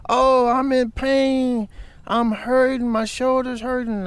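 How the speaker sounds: high, loud, slow mock wail of pain; mock screaming woman. Imitating the other driver's fake pain cries, high mock wail. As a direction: ham up a woman faking injury. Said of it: "she starts screaming and yelling".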